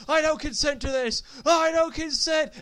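high, whiny-comic objection; mock protest. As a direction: protest it like someone being hauled away. Suspect protests being dragged from car.

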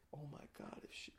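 breathless whisper, no voice at all; real stunned whisper; whispered realization. Real, not acted. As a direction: whisper it in shock, to himself. Realising aunt's suicide.

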